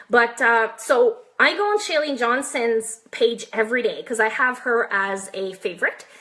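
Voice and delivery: normal talking voice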